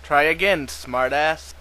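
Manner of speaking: loud, sharp game-voice scolding; loud, mocking scold; loud rebuke. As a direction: bark it back at the player. Game rejects silly name.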